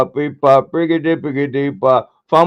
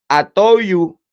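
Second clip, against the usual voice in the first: ordinary talk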